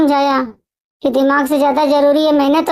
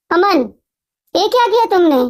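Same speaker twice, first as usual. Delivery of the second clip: a touch of a furious brother shouting; angry shout; 2 semitones higher than usual. A narrator's reading. Brother finds his savings gambled away, mild angry shout. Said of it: "Rohit गुस्से से चिल्लाया".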